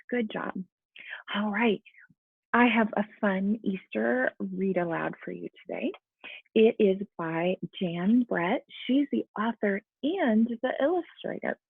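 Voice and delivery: plain reading voice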